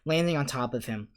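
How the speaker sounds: ordinary delivery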